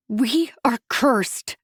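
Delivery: slow, growled demon voice; demonic growl. A narrator's reading. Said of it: "I growled, my voice sounding every bit like a demon's".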